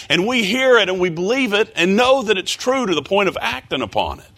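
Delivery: high, pressing preacher's voice; fervent preaching. Real, not acted. Preacher urging the church on faith, high and fervent. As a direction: drive the point of faith home.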